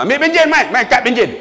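high, impatient demand; irritated clerk. Retold or imitated. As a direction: order it out sharply. Acted irritated clerk demanding card.

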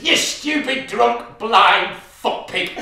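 loud, high, slow spat-out insult; furious insult. Acted. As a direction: scream it at the drunk husband. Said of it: "she screams at him".

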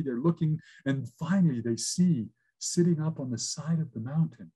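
usual speaking voice

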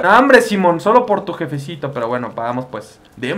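ordinary delivery

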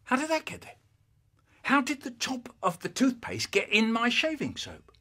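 high-pitched, mystified question; puzzled dad. Acted. Dad finding toothpaste top in his soap, puzzled. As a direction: wonder aloud, baffled. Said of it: "He's thinking".

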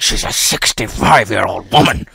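a hint of an angry headteacher scolding; angry teacher. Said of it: "and then he got angry".

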